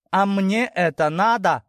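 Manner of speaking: high, loud, drawn-out huff; scoffing. A narrator's reading. Daughter rejecting family's hopes, scoffing. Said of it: "фыркнула Монро".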